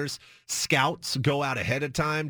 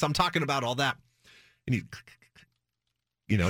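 usual speaking voice

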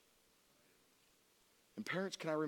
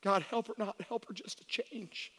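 soft, slightly high retold plea; hushed imitation of a desperate prayer; begging prayer; 5 semitones higher, 3 dB quieter than usual. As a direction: plead quietly with God, retelling it. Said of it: "I begged God".

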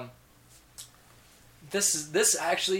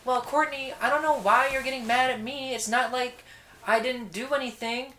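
high, louder mock mom voice; mother brushing off her daughter's anger, high and loud; defensive mom; 10 semitones higher, 6 dB louder than usual. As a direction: whine it back as the offended mother. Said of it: "Voices were spot on".

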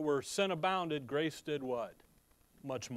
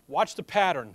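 ordinary delivery

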